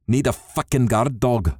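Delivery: ordinary delivery, nothing marked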